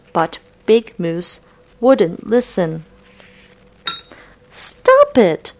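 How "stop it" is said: very high, shrill-pitched shout. Acted. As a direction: yell it out in alarm. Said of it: "shouted the Muskrat".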